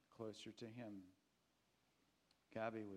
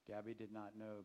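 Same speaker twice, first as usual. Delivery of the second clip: ordinary delivery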